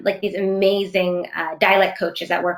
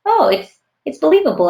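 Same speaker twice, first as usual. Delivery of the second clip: acted-out surprise, pitched up high; higher pitch, as if suddenly hearing it work; pleasant realization; 9 semitones higher, 4 dB louder than usual. Actor imagining hearing their own accent work, pleasantly surprised. Acting out hearing yourself get it.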